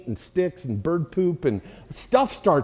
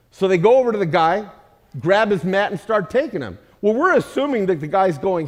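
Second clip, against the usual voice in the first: ordinary talk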